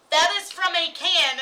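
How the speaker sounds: forceful comeback in a retold argument; emphatic insistence. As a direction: hammer it home over a denial.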